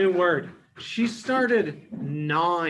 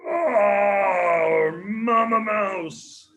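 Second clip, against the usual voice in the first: loud, high, slow cry for help; trapped lion crying out to Mama Mouse; calling for help; 4 semitones higher, 5 dB louder, much slower than usual. Acted. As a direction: wail out for rescue. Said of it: "hears his roar".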